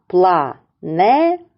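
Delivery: Планети drawn out slowly, syllable by syllable. Shown as a demonstration. Teacher slowly splits Планети for the kids, syllable by syllable. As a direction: stretch out Планети in three beats. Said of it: "Поділимо слово на склади".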